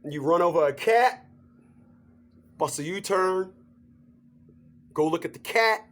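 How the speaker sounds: normal talking voice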